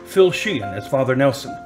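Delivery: ordinary delivery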